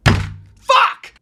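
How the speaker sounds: very high, loud single-word scream; exasperated scream. Real, not acted. Reviewer reacting to someone calling it treasure, exasperated scream. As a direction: burst out screaming in frustration. Outburst over glowing review.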